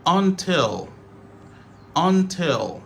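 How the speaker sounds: "Until" in two clear syllables; full form. Shown as a demonstration. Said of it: "Until devient till".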